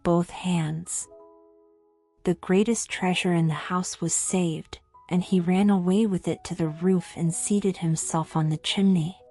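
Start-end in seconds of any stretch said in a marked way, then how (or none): none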